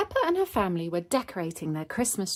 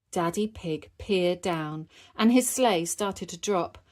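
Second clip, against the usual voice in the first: ordinary delivery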